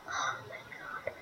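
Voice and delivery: breathless whispered disbelief; awed whisper. Real, not acted. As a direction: whisper it in shock. Stunned by a rare gift.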